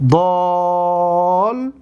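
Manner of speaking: ضالین stretched very slowly, long آ and doubled لّ; slow drawn-out demo. Shown as a demonstration. Teacher asks for attention, very slow demonstration. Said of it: "دقت بتید".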